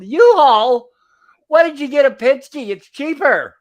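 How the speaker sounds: loud, high mock yell of indignation; exasperated shouting impression; imitated yell. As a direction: throw his outrage back, loud and high. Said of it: "And he yelled again".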